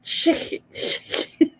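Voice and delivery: unvoiced whisper of «Chicken»; whispered practice. Shown as a demonstration. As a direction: whisper «Chicken» to try the accent. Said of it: "practising the word".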